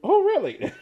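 shot up very high and loud in disbelief; a squeal of amused shock; delighted surprise. Real, not acted. Host cracks up at the answer.

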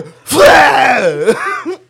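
loud, explosive mock sneeze; imitated sneeze. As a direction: blast out a pretend wet sneeze. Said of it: "sneezing on my fucking arm".

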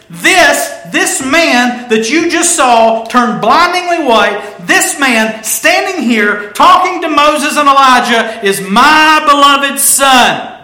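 high, loud, slow booming proclamation; voice of God. Retold or imitated. God speaking from the cloud about Jesus, slow loud booming proclamation. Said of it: "And the voice says".

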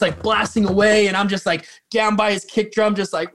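ordinary delivery

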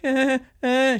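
high little nervous giggle; nervous laugh. Retold or imitated. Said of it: "she does a nervous laugh".

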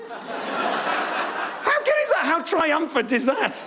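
high, drawn-out triumphant cry. Real, not acted. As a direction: crow it out slowly, high and gleeful. Triumphant punchline about granny.

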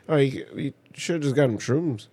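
normal talking voice

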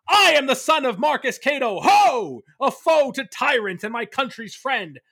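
high, shouted battle declamation in a put-on stage accent; young Cato's war cry, shouted in an old-stage accent; theatrical stage accent. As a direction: proclaim it across the battlefield in a hammy stage accent. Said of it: "I said it with an accent".

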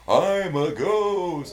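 slow, drawn-out spooky ghost moan. Retold or imitated. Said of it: "Why ghosts always talk that way? Like".

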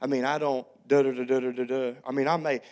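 a hint of an East Texas kid shrugging it off; East Texas teen. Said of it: "That's how they all talked".

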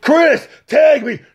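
slow, high shout for a tag; calling out. Retold or imitated. Calling to partner Chris for a tag, slow high shout. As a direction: yell across the ring.

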